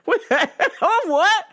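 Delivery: can't believe what you see; mock disbelief. The comic watching the IV scene, disbelieving. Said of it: "And I was like".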